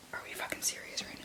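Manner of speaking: soft unvoiced whisper; hushed muttered asides. Real, not acted. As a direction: whisper as if not to wake anyone. Whispering to camera.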